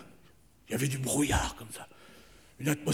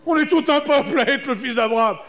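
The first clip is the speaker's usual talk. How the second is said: calling up to the sky; 9 semitones higher, 7 dB louder than usual. Pretend. Répond en criant vers le ciel.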